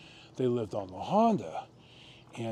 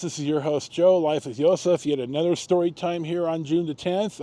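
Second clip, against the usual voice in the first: upbeat vlog host greeting, louder; cheerful sign-on to viewers; host intro; 5 semitones higher, 7 dB louder than usual. Acted. Host greets viewers in a bright, louder intro voice.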